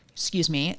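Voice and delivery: usual speaking voice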